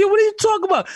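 high, loud mock bewilderment; feigned confusion. Son plays dumb to his mother, high mock confusion.

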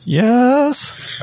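put-on high, cracking kid yes. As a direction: squeak out a nervous yes. Kid lying about his age.

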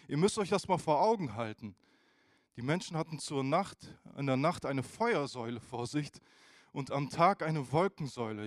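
ordinary talk

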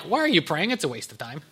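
quiet, falling away into a low throwaway; mock dismissive God. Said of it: "God's not gonna be like".

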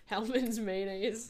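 laughing. Real, not acted. Laughing at mayo joke.

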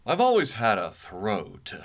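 slow, resonant radio announcer; hammed-up radio voice. Shown as a demonstration. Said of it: "do it in my radio voice".

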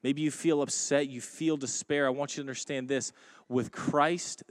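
ordinary talk, nothing marked

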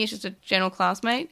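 ordinary talk

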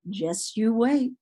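put-on the universe playfully warns a bored kid; sassy universe. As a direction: tease like the universe has a treat waiting. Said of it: "The universe was like".

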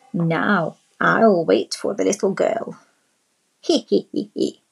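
sly wolf voice with a wicked little snicker; a villain chuckling over his trap; wolf gloating. A narrator's reading. Wolf to himself in granny's bed, sly snickering voice. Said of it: "he said to himself".

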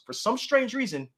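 milk the outrage of it; exasperated. Real, not acted. Annoyed about taken social handles.

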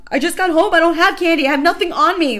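loud, high panicked shout; screaming back. Retold or imitated. She screams back at the swarming kids, loud and high. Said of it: "I'm, like, screaming back at them".

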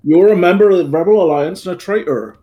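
put-on West Country accent on a villain's accusation; loud yokel space villain; Darth Vader. Player imitating outtakes of a film villain, loud West Country accent. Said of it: "he's got that accent doing Darth Vader's lines".

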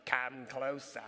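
low, quiet British accent imitation. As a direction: mimic the toy head's British accent. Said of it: "it had this British accent".

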